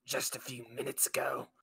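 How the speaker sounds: voiceless wolfish growl, softly done; half-manage a wolf's snarl under the breath. Acted. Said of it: "The wolf kinda snarls back".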